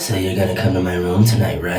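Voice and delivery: low, soft flirty come-on, lightly imitated; creepy voice. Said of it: "That's your creepy voice?".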